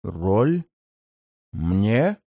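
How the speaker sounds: «Роль?» low, rising, almost genuinely surprised. Acted. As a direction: demon asks «Роль? Мне?» in low disbelief. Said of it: "Почти искренне удивился мятежный лорд".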